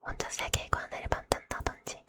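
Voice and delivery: usual speaking voice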